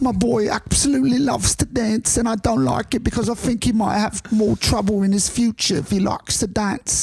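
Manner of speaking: high-pitched mock London father; English accent. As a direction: fret in a thick, comic English accent. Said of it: "How do I do the English thing?".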